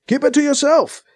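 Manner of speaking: high-pitched admonishment; admonishing. A narrator's reading. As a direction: scold them firmly. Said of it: "he admonishes B C".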